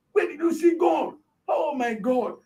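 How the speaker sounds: mock shouting in dismay; retold shouting. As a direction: exclaim like shocked bystanders, without full volume. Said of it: "They were shouting".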